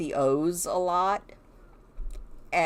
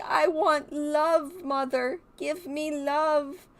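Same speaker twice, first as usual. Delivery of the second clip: put-on high, slow, pleading little-kid voice; drawn-out whiny baby plea, pitched up; needy toddler voice; 9 semitones higher, slower than usual. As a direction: beg for cuddles like a clingy toddler. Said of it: "Oh, she's like".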